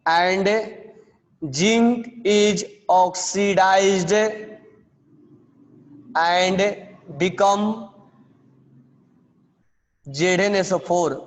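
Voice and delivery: slow, separated dictation words; steady classroom dictation. Shown as a demonstration. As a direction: pause between phrases for writing. Dictating the reaction summary.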